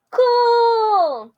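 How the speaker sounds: high, loud cry of delight; over-the-top thrilled "Cool!"; delighted cheer. Acted. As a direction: squeal with joy. Snake delighted to try.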